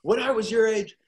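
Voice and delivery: high, slow nostalgic father voice; proud dad voice. Retold or imitated. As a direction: reminisce like a proud old dad.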